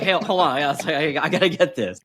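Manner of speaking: gleefully pausing him for a selfie. Retold or imitated. Retold selfie with failing student.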